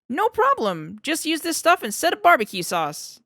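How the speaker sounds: selling his invention like a salesman; overexcited sales pitch. Acted.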